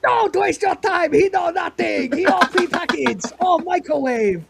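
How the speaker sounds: put-on high-pitched caricatured Chinese accent. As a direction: dismiss a rival in a hammed-up Chinese-accented vendor voice. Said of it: "stereotype of a Chinese vendor".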